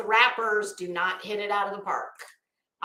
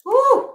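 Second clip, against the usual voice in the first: high, loud cheering woo; excited woo; 12 semitones higher, 8 dB louder than usual. Real, not acted. Cheering Future's look for viewers, a high loud woo. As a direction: whoop with delight.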